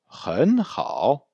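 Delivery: "Ren hao" with REN rising high, HAO dipping; joined "Ren hao", first word climbing; second-tone ren. Shown as a demonstration. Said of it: "se transformera en deuxième ton".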